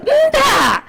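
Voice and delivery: a sudden high shout of no. Real, not acted. Preacher rebukes the proud claim. (teriakan keras dan tinggi)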